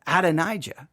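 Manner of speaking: Adonijah as ad-uh-NIGH-juh, falling; own pronunciation. Shown as a demonstration. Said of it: "questioning my own pronunciation".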